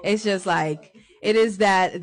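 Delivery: ordinary talk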